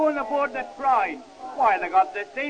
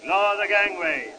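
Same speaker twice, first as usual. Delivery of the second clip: tugboat captain; 6 semitones lower, slower than usual. Acted. Officer giving docking orders.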